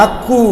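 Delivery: আক্কু with a long u after the k; আক্কু with an extra drawn vowel; wrong added vowel. Shown as a demonstration. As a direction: end আক্কু on a held oo. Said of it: "এরকম হবে না".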